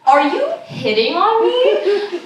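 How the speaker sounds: high, slow comic disbelief; incredulous. Retold or imitated. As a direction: draw the question out, astonished.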